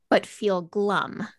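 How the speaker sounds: "feel" leaned on, with the phrase slowed right down; stress on feel. Shown as a demonstration. Host quoting the article headline, stressing "feel" slowly. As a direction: draw out "but feel glum" and lean on "feel". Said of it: "I wanna emphasize the word feel there".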